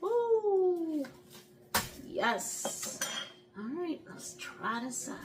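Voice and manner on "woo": high hooting woo. Real, not acted. Reacts to hot bite.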